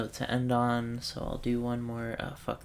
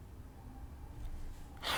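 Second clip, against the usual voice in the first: long breathy sigh; real, soft exhale; 12 dB quieter than usual. Real, not acted. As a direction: let out a sigh, stuck on a puzzle. Stuck on the puzzle.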